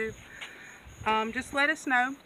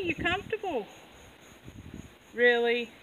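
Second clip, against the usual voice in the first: teasing pet talk. Real, not acted. Teasing goat in odd stance.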